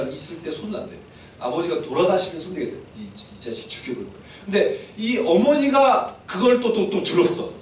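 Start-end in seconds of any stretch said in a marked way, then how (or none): 3.0-4.2 low, hushed acted threat; suppressed rage. Brother waiting for his chance, quiet suppressed anger. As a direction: threaten under your breath. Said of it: "분노가 극에 달했어요".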